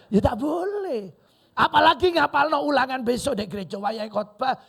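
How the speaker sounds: high, loud pulpit scolding; forceful preaching. Real, not acted. Preacher forbidding study during the church sermon, loud and forceful. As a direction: cry out the prohibition to the congregation.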